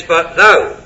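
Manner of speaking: But thou with a firm downward push on thou; stress on thou. Shown as a demonstration. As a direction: lean hard on the word thou. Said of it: "the little word thou should be emphasized".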